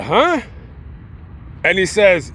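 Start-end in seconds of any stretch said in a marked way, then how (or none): 0.0-0.4 high, sharply rising questioning grunt; confused huh. Retold or imitated. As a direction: act baffled on being woken. Woken drunk man is confused.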